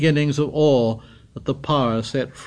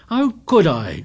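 higher, louder indignant protest; indignant cry; 6 semitones higher, 6 dB louder than usual. A narrator's reading. Piggy crying out indignantly at Ralph. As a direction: throw the blame back. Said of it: "cried Piggy indignantly".

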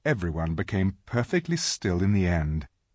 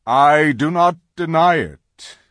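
lion voice; 7 semitones higher, 10 dB louder than usual. Acted. Aslan concedes.

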